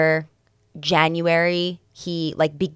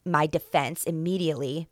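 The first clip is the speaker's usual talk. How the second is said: ordinary talk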